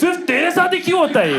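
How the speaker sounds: high-pitched exasperated parent; mock parental scolding. Parent to child who reports a mishap, mock scolding. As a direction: imitate a parent blaming the child, pitch pushed up.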